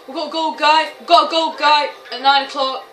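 excited battle alert; urgent callout. Real, not acted. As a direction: call out the enemy position sharply. Urgent callout of enemy to viewers.

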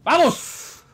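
high-pitched triumphant shout; excited cheer. Real, not acted. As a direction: cheer your teammate on. Celebra la jugada. (grito agudo de ánimo)

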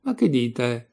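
plain reading voice

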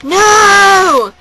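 high, loud yelled No; crowd yell. Retold or imitated. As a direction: shout it back as the whole crowd. Crowd yelling back.

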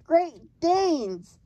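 loud, high, triumphant giant; storybook cry of victory. A narrator's reading. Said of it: "the giant cried triumphantly".

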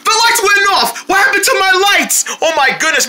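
high-pitched alarm; sudden fright at the dark; panicked. Acted. Panics as lights go out.

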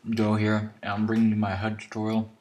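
talking while eating chips; words round a mouthful of chips; crunching chips. Real, not acted. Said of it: "I'm sorry, I'm eating chips".

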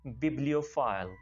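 bibliophile said slowly and clearly for repeating; slow model pronunciation. Shown as a demonstration. Teacher asking students to repeat bibliophile slowly. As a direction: spell out bibliophile slowly, syllable by syllable. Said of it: "मेरे साथ बोलिए".

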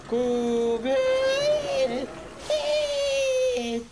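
high, loud frantic shout; panicking gamer. Real, not acted. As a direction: scream for them to run. Chased, about to die.

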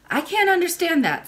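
put-on high little-girl voice; puzzled child princess. A narrator's reading. Princess baffled by the family tree, childlike protest.

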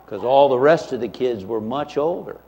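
ordinary talk

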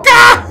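high, loud "gah!" of panic; hammed-up panicked squawk; mock startled cry. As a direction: yelp like cornered prey, played for laughs. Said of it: "he's like".

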